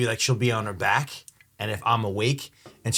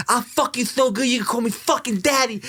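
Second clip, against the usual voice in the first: higher, lisping Tyson voice; Mike Tyson impression; 10 semitones higher than usual. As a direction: lisp it out like Tyson. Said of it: "And he's like".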